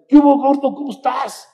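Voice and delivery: high, loud friendly greeting; friendly call. Retold or imitated. Friends greeting the chubby boy, loud and high.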